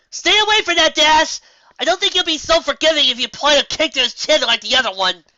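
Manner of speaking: high, loud yell across the sky; shouted warning. Acted. Twilight yells at Dash to stay away from the dragon. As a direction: call out to stop her.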